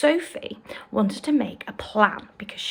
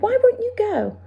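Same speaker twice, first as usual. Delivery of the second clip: much higher, louder plea; pleading cry; 12 semitones higher, 5 dB louder than usual. Acted. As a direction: beg him, near tears. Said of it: "Sophie cried".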